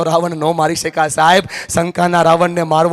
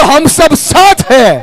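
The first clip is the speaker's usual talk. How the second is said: high, loud, drawn-out crowd chant; rallying shout; 10 semitones higher, 9 dB louder, much slower than usual. Real, not acted. Speaker rousing the gathering to chant together, rallying shout. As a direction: call it out slowly to a whole hall.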